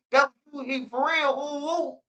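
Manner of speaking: high, rising mock excitement; mock excited friends. Friends reacting to the news, in a high mock squeal. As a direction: squeal it like the stunned homies. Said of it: "They say".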